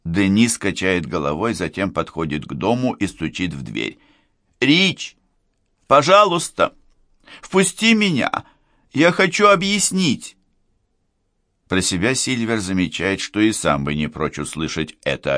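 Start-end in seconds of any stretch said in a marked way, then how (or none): 4.6-10.4 high, urgent plea through a door; raised, insistent begging; pleading. Acted. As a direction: beg to be let in, voice pitched right up. Knocking, begging to be let in.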